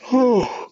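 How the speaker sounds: long high yawning sigh; a drawn-out yawn; big yawn. Real, not acted. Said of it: "here I'm yawning again".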